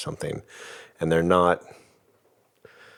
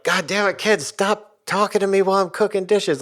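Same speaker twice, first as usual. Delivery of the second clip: put-on much higher, louder snapping yell at the kids; controlling angry yell; 6 dB louder than usual. Dad yelling at the kids to stop interrupting, pretend furious yell. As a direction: bark it to scare them into silence. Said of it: "Like, I'm trying to control them".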